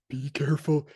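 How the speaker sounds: whispered warning swelling into a loud, drawn-out line; chilling old man. Retold or imitated. Co-host quoting the old guy's favorite chilling line, creepy warning. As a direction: start in a whisper, then stretch it out ominously. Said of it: "some really chilling lines of dialogue".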